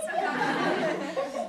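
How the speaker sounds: loud burst of laughter; big laugh mid-story; embarrassed laugh. Acted. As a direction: laugh out loud at your own memory. Character laughs at her long legs.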